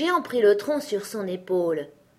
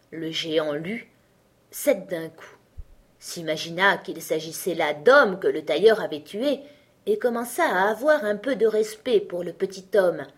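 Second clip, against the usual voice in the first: plain reading voice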